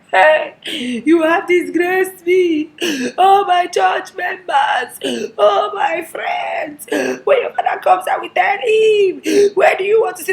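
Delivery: high, loud, drawn-out mock wailing; hammed-up crying. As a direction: wail like a disgraced mother, sobbing theatrically. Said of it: "Of course, she'll start to cry".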